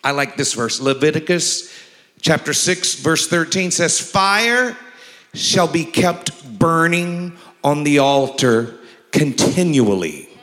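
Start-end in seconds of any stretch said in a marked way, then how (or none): 4.0-10.3 very slow, lifted pulpit reading; fervent preacher read; scripture reading. Acted. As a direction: linger on each word like incense rising.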